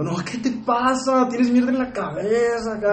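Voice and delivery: high, slow, tearful mother scolding; crying mom. Retold or imitated. Mother crying at her son, high and slow. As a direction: wail it like his mother in tears. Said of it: "mi mamá entra llorando".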